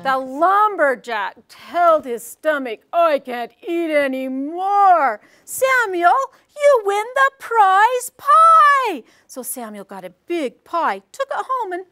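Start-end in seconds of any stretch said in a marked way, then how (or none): 2.9-5.2 slow, louder groan; defeated lumberjack. Shown as a demonstration. Lumberjack gives up on the pies, slow and louder. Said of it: "the fast and slow". 5.5-9.0 very high, loud and slow; high announcing judge. Shown as a demonstration. Judge declares Samuel the winner, high, loud and slow. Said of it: "We did the high-low".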